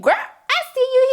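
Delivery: high, slow sassy approval; drawn-out girl I see you. Acted. Sassy praise for Keisha.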